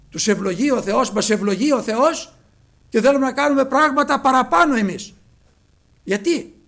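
preaching at full force. Acted. Preacher scolding believers who overreach, loud preaching. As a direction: rebuke the congregation with force. Sermon rebuke.

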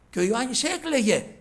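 loud, high, pressing pulpit delivery; preaching at full force. Acted. Preacher telling the church John wept, urgent loud preaching. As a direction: drive home that no one could open it.

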